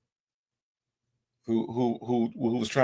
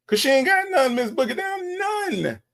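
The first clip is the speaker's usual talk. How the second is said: mocking high. Mocking high-pitched retort.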